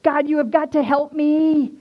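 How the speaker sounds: high, loud plea to God; desperate cry. Retold or imitated. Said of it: "I screamed to God and out of desperation".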